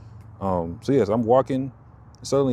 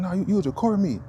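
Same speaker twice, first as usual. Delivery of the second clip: high accusing woman's voice, far higher; mocking accuser; 10 semitones higher, faster than usual. Imitating the woman who accused him, high and sharp. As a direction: squeak out her accusation.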